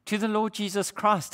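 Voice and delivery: ordinary talk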